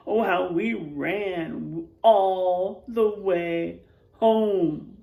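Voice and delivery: expressive storybook voice, quotes acted out; reading to children. A narrator's reading. Teacher reading a spooky picture book to the class, expressive. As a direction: read it like a spooky bedtime tale for kids.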